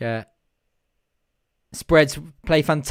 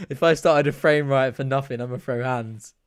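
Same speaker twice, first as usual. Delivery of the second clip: normal talking voice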